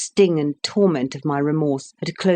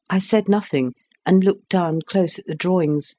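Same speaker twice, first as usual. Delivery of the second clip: plain reading voice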